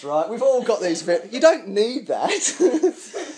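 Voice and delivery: normal talking voice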